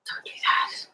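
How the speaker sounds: whispered warning; urgent whisper. Retold or imitated. Coworkers warning her about the manager, in a whisper. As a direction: hush it like a nervous coworker. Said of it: "everyone was like".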